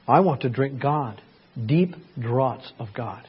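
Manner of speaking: usual speaking voice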